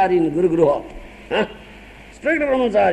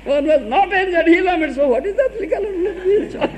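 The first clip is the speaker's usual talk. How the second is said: laughing mockery; 9 semitones higher than usual. Mocking the craving man, laughing.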